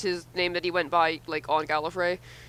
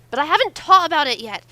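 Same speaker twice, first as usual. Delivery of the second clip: put-on kid Master voice; 7 semitones higher, 7 dB louder than usual. Shown as a demonstration. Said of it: "that's too close to Wesley".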